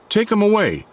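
high, sharp command. A narrator's reading. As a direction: order them off at once. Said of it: "Scott commanded".

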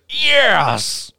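high, thrilled yelp; stoked yes. Retold or imitated. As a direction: squeal it like you just got the date. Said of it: "and he goes".

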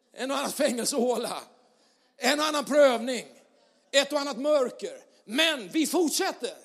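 high, loud and rising preacher's cry; preaching at full force. Acted. Pastor fires up the church, preaching loud and high. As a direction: build the congregation up, voice soaring.

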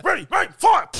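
high mock commands building to a loud yell; imitated army command. Soldiers ordering their squad to shoot zombies, imitated high shout. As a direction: bark out orders high, loudest on the last word.

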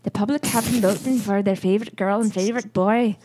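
put-on Irish announcer line; kind-of-Irish accent. Shown as a demonstration. Redoing the host's vote announcement for cohost, Irish accent. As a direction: lead in with the stock phrase, then announce in Irish accent. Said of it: "I can do kind of Irish".

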